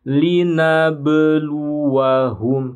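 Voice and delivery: slow melodic linabluwahum with a clear qalqalah; bounced qalqalah. Shown as a demonstration. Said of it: "Kita harus memantul".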